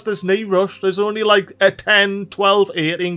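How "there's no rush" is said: ordinary talk, nothing marked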